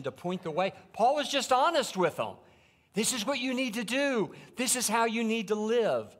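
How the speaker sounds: loud, high, urgent pulpit voice; full-force preaching. Acted. Pastor urging the fathers to live for God, preaching loud and high. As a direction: drive the point home to the men.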